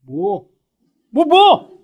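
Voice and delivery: a spouse refusing to own the fault; mock indignant. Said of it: "그렇게 해놓고도 아침에". (짜증 섞인 반문)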